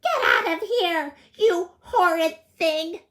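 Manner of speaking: high, loud furious shout; furious granny. A narrator's reading. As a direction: scold the wolf out of the house. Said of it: "Granny was furious".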